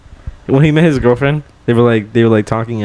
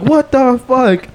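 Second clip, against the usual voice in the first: much higher, slow shocked outburst; 12 semitones higher, much slower than usual. Retold or imitated. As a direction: drag it out in disbelief. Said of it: "we're like".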